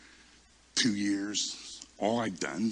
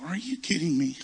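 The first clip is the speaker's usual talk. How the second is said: higher-pitched, mock-offended disbelief; incredulous disbelief; 6 semitones higher than usual. Passed-over preacher thinking to himself, incredulous in a higher voice.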